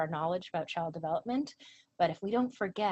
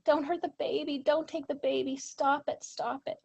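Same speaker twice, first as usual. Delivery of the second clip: high, pleading mock scream held in check; raised pitch, urgent retold plea; retold child's screaming; 6 semitones higher, 4 dB louder than usual. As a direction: imitate a frightened child begging parents. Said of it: "then she just starts screaming".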